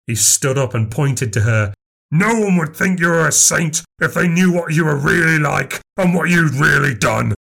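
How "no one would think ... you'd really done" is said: higher, forceful tirade; angry accusation. A narrator's reading. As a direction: denounce her in front of everyone. Max accuses Nan.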